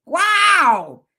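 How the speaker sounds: a playful stunned wow; shocked reaction. Retold or imitated. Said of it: "y'all be like".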